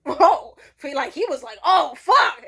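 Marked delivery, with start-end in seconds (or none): 0.0-0.6 sudden loud, raised "whoa"; imitated startled outburst; shocked whoa. Comedian shocked by the blood. 1.6-2.4 loud, high startled swear; imitated shocked outburst; alarmed curse. Comedian loses composure, retold.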